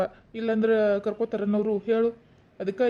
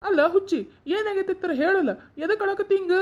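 high-pitched fond scolding concern; raised, worried voice; anxious questioning; 9 semitones higher than usual. Acted. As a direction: press the crying child for an answer. Pressing a crying child for the reason.

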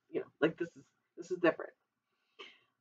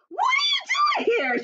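much higher, loud startled shout; giant woman crying out; 4 dB louder than usual. Acted. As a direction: cry out in shock as the giant woman. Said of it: "she cried".

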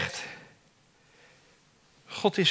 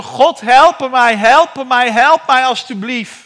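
slow, louder desperate plea; crying out for help; 7 semitones higher, 11 dB louder, slower than usual. Acted. David calling to God in need, desperate cry. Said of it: "hij zal geschreeuwd hebben".

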